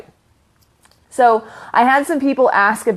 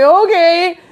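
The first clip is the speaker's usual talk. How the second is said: high-pitched mock okay; retold reply; 9 semitones higher, 4 dB louder than usual. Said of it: "And I'm like".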